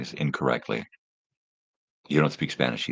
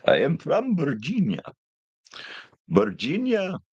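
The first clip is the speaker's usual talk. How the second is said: high-pitched, put-on Virginia accent; hammed-up Virginian accent, swooping high; mock Virginian accent; 13 semitones higher than usual. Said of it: "Do you have a Virginian accent?".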